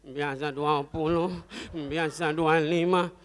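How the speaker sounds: panting pedicab driver, words dragged out slowly; out of breath. Acted. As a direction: heave each answer out while pushing uphill. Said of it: "Ini tukang becak ngos-ngosan". (ngos-ngosan mendorong becak)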